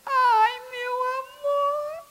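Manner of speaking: high, slow, crying with happiness; overwhelmed wife weeps with joy at her gift; tearful joy. Acted. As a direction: gush through happy tears.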